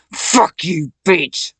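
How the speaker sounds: loud, snarled curse; furious shout. Real, not acted. Cursing Satan out of her head, furious shout. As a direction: spit it out in rage.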